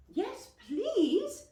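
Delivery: put-on high, loud children's voice; children answering. A narrator's reading. The children ask Aunt Amelia back, high and eager. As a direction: chirp it out like eager kids. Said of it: "we said".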